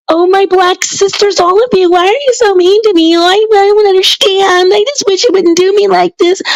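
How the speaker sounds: high falsetto mockery of a whiny plea; mock pleading sister. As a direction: squeak it out like a hurt, pleading victim. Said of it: "be like".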